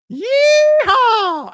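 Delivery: high, loud Southern cowboy holler; whooping Southern rodeo yell; mock Southern yeehaw. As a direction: holler a long yeehaw like a hammed-up Texan. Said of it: "That's a worse Southern accent".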